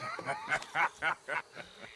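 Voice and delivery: a scornful chuckle at them; mocking laugh. Gang leader laughing scornfully at the backwoods families.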